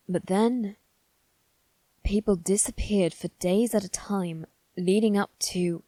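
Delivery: usual speaking voice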